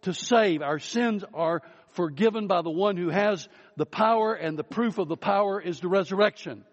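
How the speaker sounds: full-voiced sermon delivery; preaching. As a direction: proclaim the power to the congregation. Opening proclamation of resurrection power.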